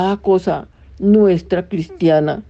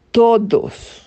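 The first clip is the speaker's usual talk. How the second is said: todos lifted higher and held with a falling close; stress on todos; 4 semitones higher than usual. Shown as a demonstration. Preacher repeating the Lady's words to the congregation, stressing todos. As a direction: lean hard on the word todos, higher, then let it fall. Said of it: "recalca la palabra".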